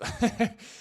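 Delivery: short, breathy real laugh; quick chuckle at an absurd claim; brief spontaneous laugh; amused chuckle. Real, not acted. Amused by claim about oranges.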